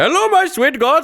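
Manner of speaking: put-on high-pitched caricature Indian accent; fake Indian accent. Mimicking a streamer's old catchphrase, fake Indian accent. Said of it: "the fake stereotype Indian accent".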